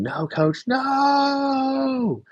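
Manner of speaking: imitated yell, climbing very high on the last no; mock scream. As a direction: wail it at the TV, half-joking.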